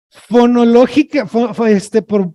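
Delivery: ordinary talk, nothing marked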